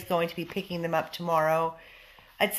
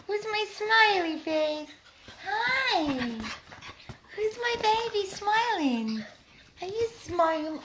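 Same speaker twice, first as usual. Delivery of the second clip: talking to a dog; 12 semitones higher, 3 dB quieter than usual. Real, not acted. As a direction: squeal sweetly at the pup. Cooing at the dog.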